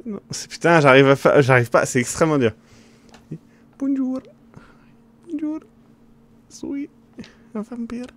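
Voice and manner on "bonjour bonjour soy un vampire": high, soft put-on vampire greeting; squeaky vampire. Streamer improvising a vampire character, high and soft. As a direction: play a silly high-pitched vampire. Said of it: "Avec des aigus".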